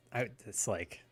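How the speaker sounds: ordinary delivery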